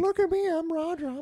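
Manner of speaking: high, put-on show-off voice; mock boast. Teasing a friend about his perfect dad, high mock boast. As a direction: strut like a goofy dad.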